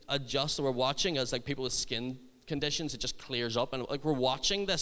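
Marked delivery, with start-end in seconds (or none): none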